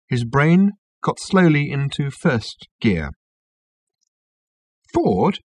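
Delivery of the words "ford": higher-pitched sudden call of a name; sudden realisation. A narrator's reading. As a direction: blurt it as an idea strikes. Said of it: "he said suddenly".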